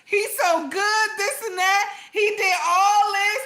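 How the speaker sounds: high, put-on gushing praise with rolled-eye sarcasm; mock sincere praise. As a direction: gush over him but plainly not mean it. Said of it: "I would have been looking at like".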